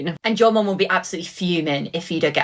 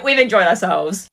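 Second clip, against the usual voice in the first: normal talking voice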